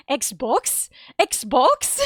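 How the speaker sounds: mock incredulous "Xbox?", rising at the end; lightly put-on funny voice of disbelief; imitating baffled professor. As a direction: echo the professor, questioning and puzzled. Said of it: "how to say Xbox in a funny voice".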